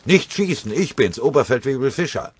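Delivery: very high, loud desperate cry; shouting. Acted. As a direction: call out so they won't shoot. (verzweifelt geschrien)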